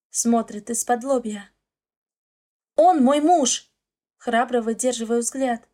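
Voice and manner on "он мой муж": loud defiant cry; defiant shout. Acted. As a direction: shout it back bravely. Defending husband in a fight.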